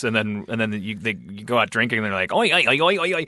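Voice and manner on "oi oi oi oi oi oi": hammed-up New Zealand "oi" chatter; mock drunk New Zealander, higher-pitched; bad New Zealand accent. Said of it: "your New Zealand accent sounds like".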